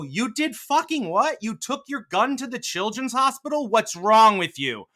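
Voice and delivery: high, loud, slow incredulous outcry; horrified at what they hear; aghast disbelief. Retold or imitated. Sane people confronting a crazy person, loud horrified disbelief. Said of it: "All sane people go".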